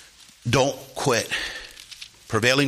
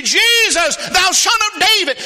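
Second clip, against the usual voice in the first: high, loud plea to Jesus; crying out; 9 dB louder than usual. Acted. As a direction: call out like a desperate blind beggar. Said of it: "He began to cry out".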